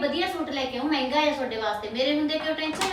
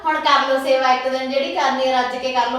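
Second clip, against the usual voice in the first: louder, exasperated rant; fed-up outburst; 7 dB louder than usual. Acted. Wife venting to husband about greedy guests, loud and fed up. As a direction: vent it hard at the relatives.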